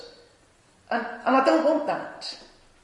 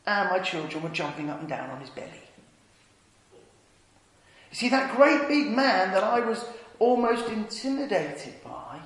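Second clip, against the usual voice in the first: ordinary delivery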